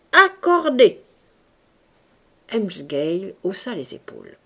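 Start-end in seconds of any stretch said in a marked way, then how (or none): 0.1-1.0 loud, high one-word shout; crowd shouting assent. A narrator's reading. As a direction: shout agreement from all sides. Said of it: "On cria de toutes parts". (accord crié haut et fort)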